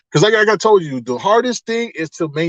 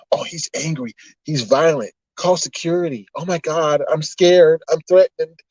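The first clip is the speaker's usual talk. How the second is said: put-on frightened bystander voice; mock alarmed accuser; 2 semitones higher than usual. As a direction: imitate a fussy, panicky complainer. Mimics alarmed accusers calling security.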